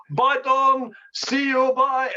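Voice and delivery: high, breezy sing-song farewell; cheery goodbye. Retold or imitated. Chinese footballer waving Don off at the plane, high breezy goodbye. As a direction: chirp a carefree goodbye.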